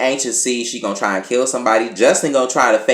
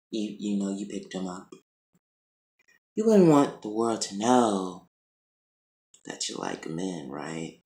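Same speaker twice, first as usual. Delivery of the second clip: acted-out threat, softer and measured; mock-menacing, dropping quieter toward the end; veiled threat; 3 semitones lower, 7 dB quieter than usual. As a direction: deliver a hushed, knowing threat. Acting out Candace's veiled threat.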